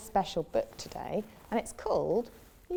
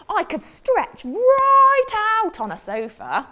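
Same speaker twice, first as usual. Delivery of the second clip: high, louder hopeful question; hopeful frog; 12 semitones higher, 11 dB louder than usual. Acted. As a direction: ask brightly hoping for yes. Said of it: "said the frog".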